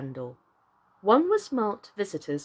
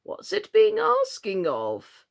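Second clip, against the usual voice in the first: higher, slow croaking frog; hoarse frog; 12 semitones higher, slower than usual. A narrator's reading. Old frog quizzes Alice, hoarse and slow. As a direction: rasp the question out. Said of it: "He was so hoarse".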